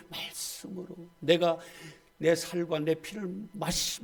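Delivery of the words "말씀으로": breathy near-whisper; whispered answer. Shown as a demonstration. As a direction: let the word 말씀으로 fall away to a whisper. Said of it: "뭐예요?".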